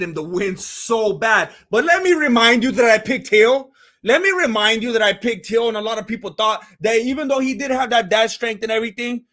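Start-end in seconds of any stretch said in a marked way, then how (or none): none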